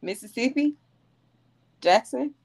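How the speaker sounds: high, rising question-like reply; puzzled answer. Retold or imitated. Newcomer telling Texans her hometown, puzzled answer. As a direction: answer as if unsure why they ask.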